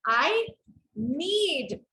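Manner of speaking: "I", a pause, then a long-drawn "need"; stress on NEED. Shown as a demonstration. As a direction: pause and lean slowly on "need". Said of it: "that is where I want to place my stress".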